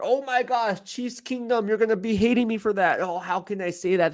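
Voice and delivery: high-pitched mock fan dismay; mock outrage. Host teasing Chiefs Kingdom about hating him, mock outrage.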